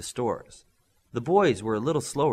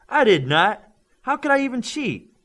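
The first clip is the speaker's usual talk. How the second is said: indignant denial; 14 semitones higher, 8 dB louder than usual. Acted. Denies cheating, indignant.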